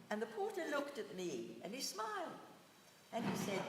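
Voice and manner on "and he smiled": ordinary talk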